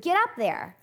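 high, loud, pushy urging; pushy mom goading. Retold or imitated. Mom goading her scared daughter up the tree, loud and pushy. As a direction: egg the child on up the ladder. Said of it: "She's like".

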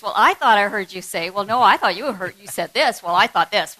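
loud mock-bickering quotes. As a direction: act out the couple's back-and-forth. Mock couple's misunderstanding.

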